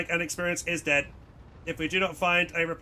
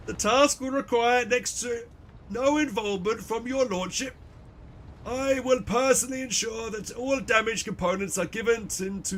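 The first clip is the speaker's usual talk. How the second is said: higher, slowed official drone; High Factorum voice; 4 semitones higher than usual. Acted. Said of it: "I'm trying so hard with these voices".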